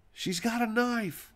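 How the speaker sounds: high, frantic cry of alarm; retold scream. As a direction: shriek it like a panicked woman. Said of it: "I hear the lady scream".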